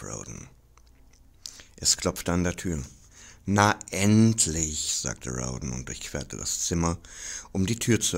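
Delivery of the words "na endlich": loud, drawn-out, higher exclamation; impatient relief. A narrator's reading. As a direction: stretch it out like an overdue arrival. Hungry man's long-awaited breakfast arrives. (ungeduldig ausgerufen)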